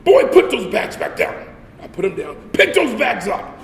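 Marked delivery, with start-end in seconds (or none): 0.0-1.4 loud, higher barked command; drill order. Retold or imitated. Sergeant barking orders. 2.5-3.6 slow, loud, higher command; drill order. Retold or imitated. Sergeant barking orders.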